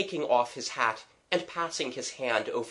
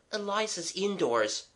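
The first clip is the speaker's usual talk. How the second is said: put-on high, light girl's reply; reassuring girl; 8 semitones higher than usual. Mabel telling him Eliza's indoors, calm and high. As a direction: inform him calmly, pitched up.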